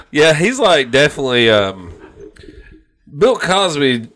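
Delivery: ordinary talk, nothing marked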